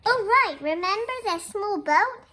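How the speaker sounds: high, loud eager discovery; light up as you find it; excited. Real, not acted. Teacher finding the small boat in the picture for the class, excited.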